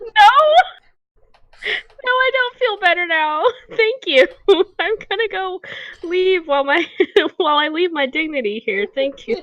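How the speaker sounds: high, giggling protest broken by laughter; laughing through it. Retold or imitated. Retelling her brush-off to friends, laughing throughout. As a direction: laugh while insisting you're fine.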